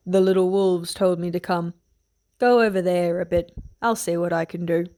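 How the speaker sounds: put-on a mild rough old soldier; a hint of a gruff man. A narrator's reading. Guard speaking to Brienne, lightly gruff. Sandor voiced lower.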